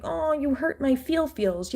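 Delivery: put-on high, slow babyish whine; mock them as a hurt child; mock wounded baby voice. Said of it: "act like".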